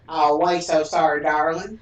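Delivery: loud, hammed-up Southern accent; exaggerated Southern apology; mock Southern accent. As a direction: lay on a mocking Southern accent. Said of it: "your accent is so clearly mockery accent".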